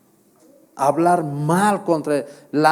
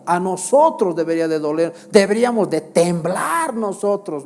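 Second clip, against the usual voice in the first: rising, louder preacher's plea to the church; fervent preaching; 3 semitones higher, 4 dB louder than usual. Real, not acted. Preacher urging his congregation to tremble, fervent preaching. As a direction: build the sermon to a trembling climax.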